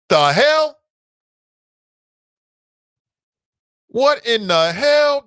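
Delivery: high, loud, slow disbelief; incredulous. Real, not acted. Vlogger reacting to Cameron's Halloween photo, loud disbelief. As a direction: draw it out in shock.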